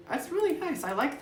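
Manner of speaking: high delighted. Real, not acted. Admiring the stylized walking.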